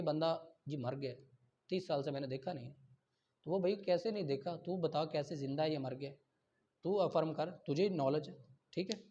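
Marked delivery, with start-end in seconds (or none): none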